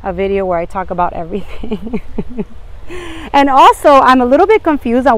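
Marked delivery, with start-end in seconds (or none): 2.1-3.3 short laugh. Real, not acted. Laughs at her long video.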